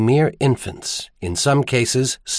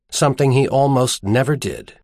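ordinary delivery, nothing marked

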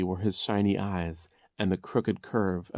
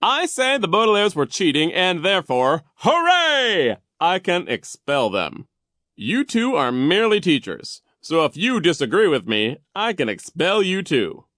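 loud, high bossy pronouncement; pompous Vice Principal Nero; 10 semitones higher, 10 dB louder than usual. A narrator's reading. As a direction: pull rank with imperious force. Narrator plays Vice Principal Nero.

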